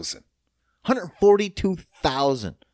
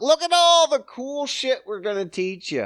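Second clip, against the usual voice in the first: mock boastful; 10 semitones higher than usual.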